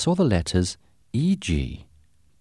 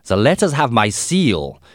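put-on insistent, higher-pitched German accent; strong German accent; 4 semitones higher, 4 dB louder than usual. A narrator's reading. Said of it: "He had a strong German accent".

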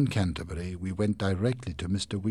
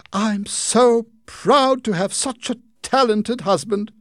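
high, slow, louder proud wife; wife's voice; 12 dB louder, slower than usual. Acted. Wife praising her husband, high and proud. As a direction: beam over your husband.

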